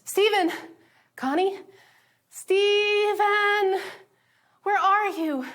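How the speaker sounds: high, loud calls across distance; calling out. Retold or imitated. Steven and Connie calling each other across the wreckage. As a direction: shout to find each other.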